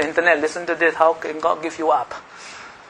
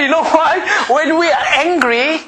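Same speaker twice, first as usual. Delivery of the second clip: laughing; 12 semitones higher, 5 dB louder than usual. Real, not acted. Laughing at his own skit.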